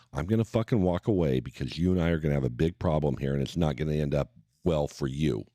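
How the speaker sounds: low, controlled threat; retold warning. Husband warns rude passenger to back off, low retold threat.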